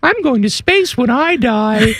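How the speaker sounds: high, slow cartoonish Tom Cruise voice; squeaky cartoon-character take on Tom Cruise; Tom Cruise impression. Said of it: "I'm gonna give you a little Tom Cruise interview".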